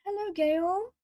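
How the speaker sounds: a bright, high call of hello; high greeting. Real, not acted. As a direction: lift the voice way up to greet Gail. Greeting someone who comes in.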